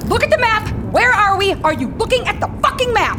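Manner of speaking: yelling. Acted. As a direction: snap at the navigator, sharp and high. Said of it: "I'm sorry I yelled at you".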